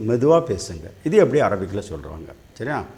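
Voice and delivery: ordinary talk, nothing marked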